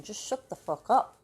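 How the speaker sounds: blurted shut-up. Retold or imitated. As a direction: cut the chatterbox off sharply.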